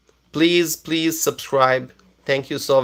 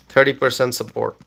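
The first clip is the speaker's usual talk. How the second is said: ordinary talk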